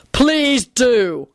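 curt, spiteful send-off; nasty bark. A narrator's reading. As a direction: bark it out nastily. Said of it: "Quentin barked out nastily".

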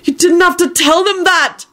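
high, outraged protest; furious shout. A narrator's reading. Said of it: "Neville shouted furiously".

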